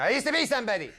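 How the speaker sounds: has-been Doberman. Acted.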